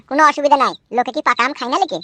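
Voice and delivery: ordinary talk, nothing marked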